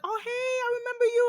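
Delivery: gushing greeter. Retold or imitated. People spotting the host out, imitated gushing greeting. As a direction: imitate someone greeting you in a high voice. Voices people who recognize her.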